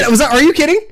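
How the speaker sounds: high-pitched disbelief; shocked, almost squealing; surprised. Real, not acted. Shocked by the hat twist.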